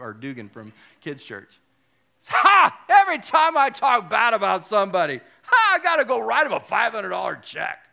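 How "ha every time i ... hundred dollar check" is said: loud exuberant voice with barked laughs; Bob Goff impression. Imitating him lamenting his five hundred dollar checks, hollered impression. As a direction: burst out laughing "ha", then holler on. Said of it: "He laughs like that".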